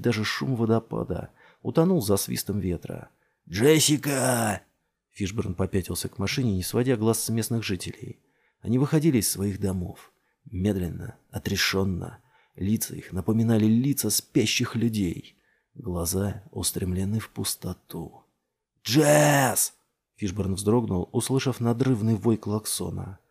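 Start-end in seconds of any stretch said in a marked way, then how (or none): 3.5-4.6 long drawn-out, high call; stretched shout into the wind; shouting a name. A narrator's reading. Fishborne shouting over the wind. 18.8-19.7 loud, high, held shout; urgent raised call; shouting a name. A narrator's reading. Fishborne still shouting for Jess.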